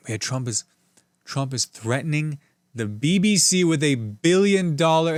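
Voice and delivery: ordinary delivery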